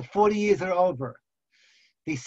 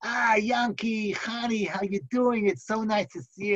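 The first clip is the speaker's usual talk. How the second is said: big-smile greeting; 4 semitones higher than usual. Acted. Leader welcomes a visiting young couple, beaming. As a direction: greet dear visitors with hammed-up delight. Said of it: "gives them a big smile".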